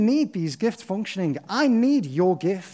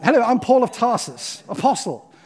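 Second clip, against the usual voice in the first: mock posh accent, higher and louder, grandly announcing himself; posh Paul; 5 semitones higher, 7 dB louder than usual. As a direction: play a pompous upper-class Paul. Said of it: "I don't know why he's posh".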